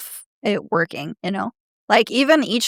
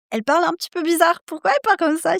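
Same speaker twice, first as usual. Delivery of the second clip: puzzled; 8 semitones higher, much faster than usual. Retold or imitated. Imitates puzzled listeners' reaction to her Serbian.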